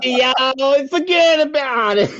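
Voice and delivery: loud, high New York tough guy; New York accent. Pretend. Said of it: "What would a New York walrus sound like?".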